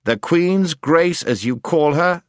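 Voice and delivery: stern. A narrator's reading. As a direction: speak sternly and deliberately. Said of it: "said the prince sternly".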